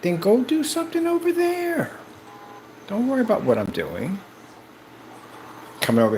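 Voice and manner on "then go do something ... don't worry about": mock dismissal. Telling naysayers to leave.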